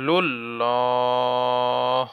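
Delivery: লুল্লাহ with the vowel held for three beats, very slow; three-alif stretch. Shown as a demonstration. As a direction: stretch লুল্লাহ long and heavy. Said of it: "তিন আলিফ টান".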